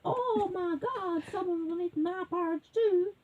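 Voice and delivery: high-pitched mock wail; dismayed mommy bear. A narrator's reading. Mommy bear finding her porridge eaten, wailing in dismay. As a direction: act a high, upset mother bear. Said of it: "mommy bear says".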